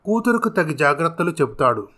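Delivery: ordinary delivery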